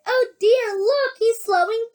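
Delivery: put-on high, worried boy voice; young boy. A narrator's reading. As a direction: exclaim in a high child's voice. Boy Charlie watching, worried.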